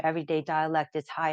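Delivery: ordinary talk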